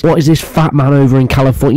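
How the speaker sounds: normal talking voice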